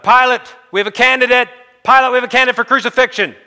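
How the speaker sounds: high, very loud crowd yell; yelling for Pilate. Acted. Priests outside the palace calling for Pilate, yelling. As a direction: bellow up at the palace windows. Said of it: "they're yelling for Pilate".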